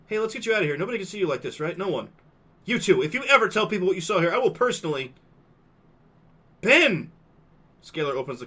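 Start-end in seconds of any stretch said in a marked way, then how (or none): 0.1-5.1 nervous threat. Acted. Hushed urging then threat. 6.6-7.1 high, loud shout of his name; sharp call. Acted. Calling out sharply.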